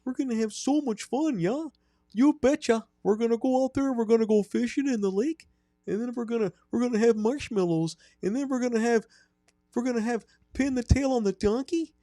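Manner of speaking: put-on high-pitched Wisconsin hockey accent; high, cheery Midwestern creep; creepy camp counselor. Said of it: "Sell it".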